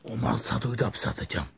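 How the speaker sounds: ordinary talk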